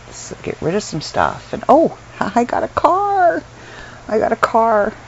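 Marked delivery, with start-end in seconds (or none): 1.7-4.9 high, drawn-out happy squeal with a laugh; giddy, higher-pitched announcement; excited. Real, not acted. Vlogger sharing news of a new car, excited. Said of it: "I'm so excited".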